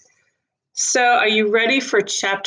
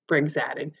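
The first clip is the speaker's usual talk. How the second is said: ordinary delivery, nothing marked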